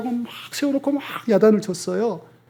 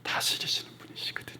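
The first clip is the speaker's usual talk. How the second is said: whispered reveal; 16 dB quieter, faster than usual. Real, not acted. Preacher reveals key point.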